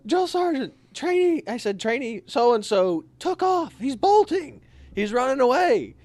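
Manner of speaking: high, urgent out-of-breath report; breathless report. Retold or imitated. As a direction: gasp it out after sprinting.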